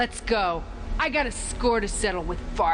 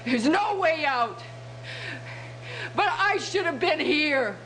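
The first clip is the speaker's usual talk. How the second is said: high, tearful despair; anguished outburst; 8 semitones higher than usual. Acted. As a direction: cry out in guilt and despair. Trapped soldier breaks down over dead comrades.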